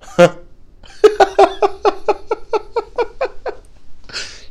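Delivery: long, very high giggling fit; real high-pitched laugh. Real, not acted. Laughs at own bit.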